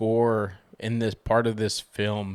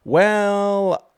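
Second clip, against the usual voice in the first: "Well" sung out much higher and louder; drawn-out showman "Well"; 10 semitones higher, 6 dB louder than usual. Pretend. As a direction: stretch "Well" up high like a host. Showy drawn-out segment opener.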